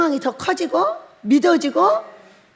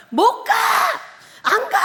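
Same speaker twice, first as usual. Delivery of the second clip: hammed-up bawling protest; 10 semitones higher than usual. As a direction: bawl it out like a stubborn child refusing. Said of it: "그렇게 울고 있는 거예요". (떼쓰며 우는 흉내)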